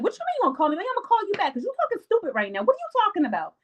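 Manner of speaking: high, fast, indignant squeal; mock outrage. Speaker playing herself answering a friend, high indignant mock outrage. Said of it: "And I would be like".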